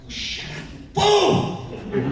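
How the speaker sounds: high, loud furious cartoon chihuahua; shrieking slow cartoon threat; Ren voice. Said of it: "yang and yin".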